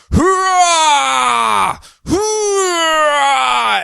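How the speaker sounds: long, high, loud straining roar; straining yell. Retold or imitated. Captured Superman straining himself, hammed-up straining yell. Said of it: "straining himself".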